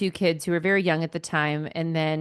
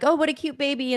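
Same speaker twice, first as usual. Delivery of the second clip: cooing stranger; 9 semitones higher than usual. Retold or imitated. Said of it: "people were like".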